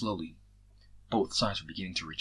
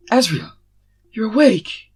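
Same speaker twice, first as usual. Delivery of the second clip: high, loud joyful cry; overjoyed; 13 semitones higher, 15 dB louder, slower than usual. A narrator's reading. Ash seeing Azrael awake at last, high overjoyed cry. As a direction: burst out with relief and joy. Friend sees him awake.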